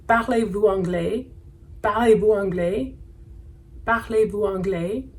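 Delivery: "Parlez-vous anglais?" rising at the end as a question; teacher modelling "Parlez-vous anglais?" with a clear final rise; questioning intonation. Shown as a demonstration. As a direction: lift the pitch on the end of "anglais". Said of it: "using intonation to indicate that we're asking a question".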